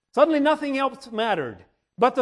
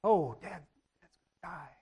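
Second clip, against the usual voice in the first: soft, slow, low grieving; tearful lament; 5 semitones lower, 9 dB quieter than usual. Retold or imitated. Family weeping for their bitten dad, soft and grieving. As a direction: mourn it quietly, breaking.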